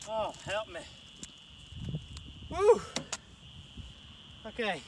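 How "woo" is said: high loud whoop of effort; excited whoop. Real, not acted. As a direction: let out a big cheer. Nearing the finish line.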